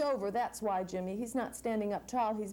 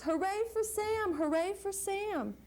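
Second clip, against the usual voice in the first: mild trumpeting cheer; 8 semitones higher than usual. Acted. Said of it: "Elephant trumpets".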